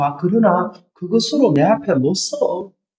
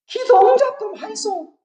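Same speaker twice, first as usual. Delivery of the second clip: mock whining plea; 13 semitones higher than usual. Mocking pious demand.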